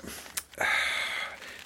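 long breathy sigh; heavy sigh. Acted. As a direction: let out a long, weary breath. Sigh after painful news.

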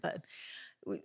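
ordinary delivery

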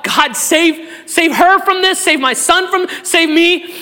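retelling his own desperate prayers, loud and high; crying out to God. Retold or imitated. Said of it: "I've cried out".

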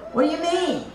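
high, disbelieving question; amazed at the cabbage money, a high incredulous question. Retold or imitated. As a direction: pitch it up in disbelief. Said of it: "And that's how I look".

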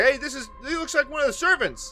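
high-pitched, excitable lawman voice; lawman character. Acted. Lawman spotting a servant on the ground, high character voice. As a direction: play a high-voiced lawman sizing someone up. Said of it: "He's looking around, he's like".

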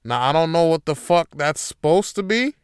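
normal talking voice

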